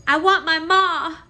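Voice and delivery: high, slow wail for her mother; high, slow childlike cry for ma; cried out. A narrator's reading. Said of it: "cried Midge".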